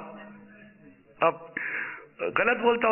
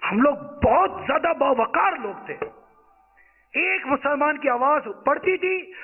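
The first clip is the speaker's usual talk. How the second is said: impassioned preaching; 8 semitones higher than usual. Acted. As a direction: mourn past glory to the crowd.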